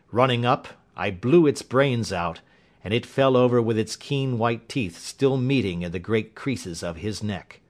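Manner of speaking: plain reading voice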